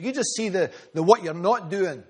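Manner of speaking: usual speaking voice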